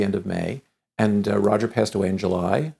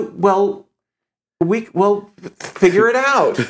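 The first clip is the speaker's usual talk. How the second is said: high, slow, louder put-on voice; mock Cher insisting; 10 semitones higher, 5 dB louder, slower than usual. Cher telling him to figure it out. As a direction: drawl out Cher's push.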